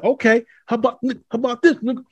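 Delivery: high sneering taunt of a heckler; mock taunt. Imitating the slur caller.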